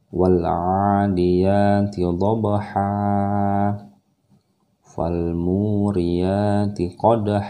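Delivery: slow melodic chant; Quran recitation. Shown as a demonstration. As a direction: recite with tajwid. Recitation demo.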